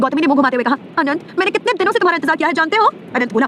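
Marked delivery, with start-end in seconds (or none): 1.0-2.9 tag withheld. A narrator's reading. Plaintive appeal to beloved who shuns her.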